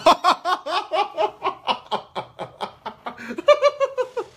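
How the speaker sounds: long, high helpless laugh; real laughing fit. Real, not acted. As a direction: laugh until the breath runs out. Reads rude chat insult, laughs.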